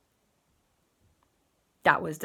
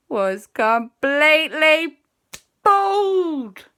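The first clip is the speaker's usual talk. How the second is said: dramatic reveal; 9 semitones higher, 9 dB louder, much slower than usual. A narrator's reading.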